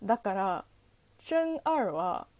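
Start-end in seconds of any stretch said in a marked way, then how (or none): 1.2-1.9 真二 said slowly in Mandarin, as "zhen er"; slow Chinese pronunciation of 真二; Chinese reading. Shown as a demonstration. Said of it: "中国語読みだと".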